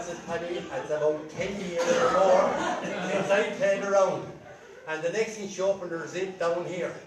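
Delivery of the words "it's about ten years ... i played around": śmiech w głosie, wolno i głośniej; laughing through it. Acted. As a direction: laugh the words out slowly and louder. Paddy laughing at the question.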